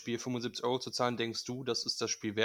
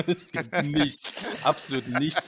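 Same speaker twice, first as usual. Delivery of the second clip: laughing emphasis; 4 semitones higher, 8 dB louder, slower than usual. Real, not acted. Mock outrage amid shared laughter.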